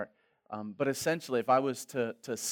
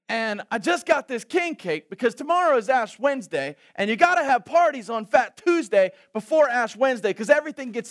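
high, loud, breathless rattling explanation; excited friend; 10 semitones higher, 8 dB louder than usual. Retold or imitated. New Orleans friend explaining Fat Tuesday, high and excited. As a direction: gush it out like it's your holiday.

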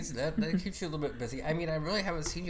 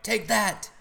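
loud, higher victory cry; triumphant gloating; 7 semitones higher, 7 dB louder than usual. Retold or imitated. Gamer to the player just shot, triumphant gloating. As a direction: hurl it at a beaten opponent. Retold gloating after a shotgun kill.

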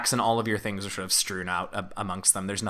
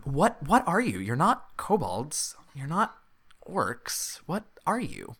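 raised, quizzical little kobold voice; puzzled kobold; 5 semitones higher, slower than usual. Acted. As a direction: puzzle out these strange creatures, haltingly.

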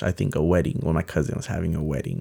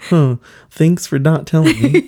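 laughing tease; 9 semitones higher, 8 dB louder than usual. Retold or imitated. Family teasing they knew.

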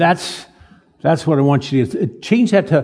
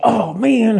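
high, drawn-out exasperated groan of words; mock dismay, pitched up; acted dismay; 7 semitones higher than usual. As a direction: act dismayed at a fresh stain. Acting dismay at muddy uniform.

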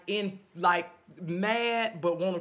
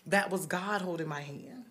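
ordinary delivery

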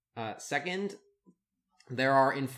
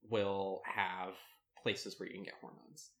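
ordinary talk, nothing marked

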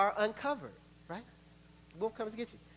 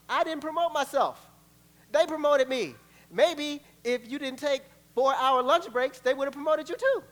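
higher, louder, sassy indignant rant; indignant coworker; 6 semitones higher, 5 dB louder than usual. Acted. Worker of twelve years scolding a rival, indignant. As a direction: tell off the new hire. Acted office quarrel.

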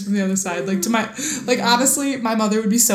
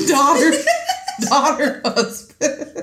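laughing; 7 semitones higher, much slower than usual. Real, not acted.

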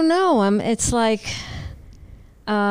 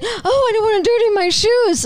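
high, loud whiny impression; mock him as a fussy brat; 10 semitones higher, 5 dB louder than usual. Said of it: "you would be saying".

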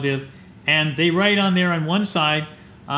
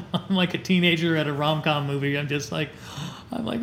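ordinary talk, nothing marked

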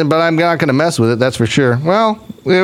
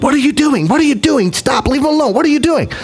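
raised, high urgent voice, pleading with her husband; alarmed pleading; 7 semitones higher than usual. Retold or imitated. Wife in car urging husband to leave wizard alone, high alarmed pleading. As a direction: panicky wife begging him to stop. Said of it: "Missy's like".

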